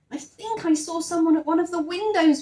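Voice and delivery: excited little bear calling to his friend; calling excitedly. Acted. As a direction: call out thrilled, hoping it's the Queen. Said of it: "he called excitedly".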